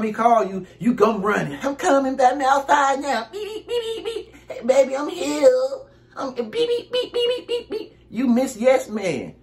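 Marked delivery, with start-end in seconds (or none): 1.6-3.3 put-on high, eager lover-boy voice; mocking eager boyfriend. 3.3-4.3 high, soft beeping with a laugh; imitated car horn. 4.5-5.5 put-on high, eager lover-boy voice; mocking eager boyfriend. 6.1-8.0 high, soft beeping with a laugh; imitated car horn.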